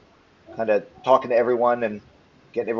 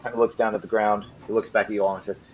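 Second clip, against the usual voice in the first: ordinary talk, nothing marked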